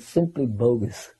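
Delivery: unhurried mock dismissal, about to laugh; imitating Prabhupada. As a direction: imitate a guru's drawn-out verdict, softly. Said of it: "he said it in such a wonderful way".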